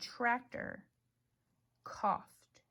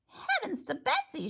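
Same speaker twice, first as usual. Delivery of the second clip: very high startled exclaim; surprised exclamation; 13 semitones higher than usual. Acted. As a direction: gasp it out in surprise. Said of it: "she exclaimed".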